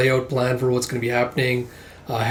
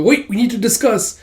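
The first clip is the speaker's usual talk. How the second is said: high-pitched acted interruption; urgent interjection; 9 semitones higher, 3 dB louder than usual. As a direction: cut in urgently in a higher voice. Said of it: "He heads back inside and goes".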